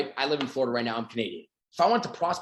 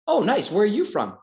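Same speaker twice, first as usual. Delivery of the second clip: bright greeting; 6 semitones higher than usual. Acted. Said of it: "I could literally just say".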